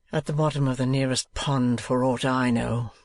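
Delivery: usual speaking voice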